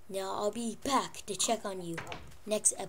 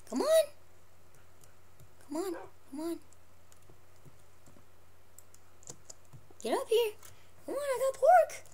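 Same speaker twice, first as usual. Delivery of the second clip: high squeaky coaxing; coaxing pet voice; 11 semitones higher, 3 dB quieter than usual. Real, not acted. Coaxing pet up onto bed.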